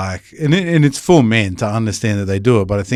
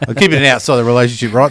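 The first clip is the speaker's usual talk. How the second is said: ordinary delivery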